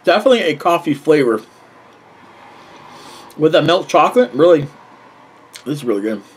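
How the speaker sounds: talking between bites; chewing while talking. Real, not acted. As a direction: mouth partly full. Seen 0: Bites bar at -5, closed-lip chewing mid-speech, bar in hand.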